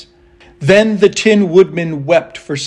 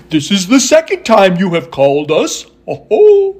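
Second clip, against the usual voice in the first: monkey whoops round swooping speech; hooting Monkey King; 4 semitones higher, 5 dB louder than usual. A narrator's reading. Monkey King greeting Dorothy, whooping monkey voice. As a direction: hoot like a monkey, leaping high at the end. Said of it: "said the Monkey King".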